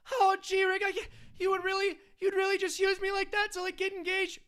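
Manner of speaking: put-on high, nervous whiny teen voice, way up in pitch; squeaky stammering teenage sidekick, pleading; Morty impression. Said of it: "doing a Rick and Morty impression".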